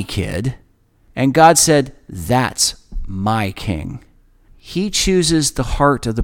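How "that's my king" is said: God speaking. Acted. God's voice quoted in sermon.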